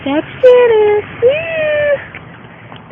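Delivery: high-pitched excited whoop; excited squeal. Real, not acted. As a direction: squeal with delight.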